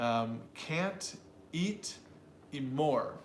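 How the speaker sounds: eat drawn out slowly and stressed. Shown as a demonstration. Teacher demonstrating stress on eat for students. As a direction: stretch and hit eat. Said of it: "stressing that word".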